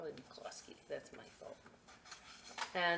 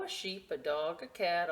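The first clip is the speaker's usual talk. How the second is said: usual speaking voice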